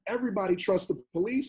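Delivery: normal talking voice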